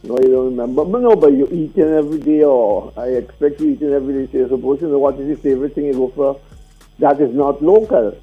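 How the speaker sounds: ordinary talk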